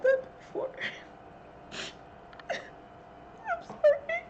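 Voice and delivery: sobbing laugh, breaking high; breaking down mid-sentence; crying. Real, not acted. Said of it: "I'm so sorry".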